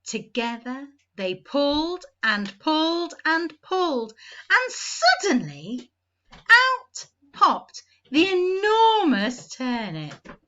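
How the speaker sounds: ordinary delivery, nothing marked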